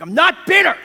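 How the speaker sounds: high, loud defensive snap; angry denial. Retold or imitated. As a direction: shout it back, insisting you're fine. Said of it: "And I'm like".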